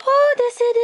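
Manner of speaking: high, loud exaggerated oh; mock surprise. Fake surprise at more CDs. (fausse surprise, très aiguë et forte)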